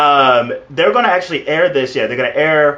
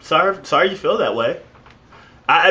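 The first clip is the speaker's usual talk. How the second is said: normal talking voice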